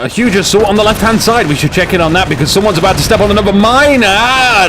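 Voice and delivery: urgent live-cast build-up to a cry; excited commentary. Real, not acted. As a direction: call the danger as it unfolds. Live cast, mine about to hit.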